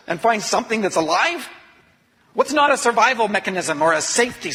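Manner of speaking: anguished. Real, not acted. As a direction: plead to the void, voice breaking high. Despair at the cliff edge.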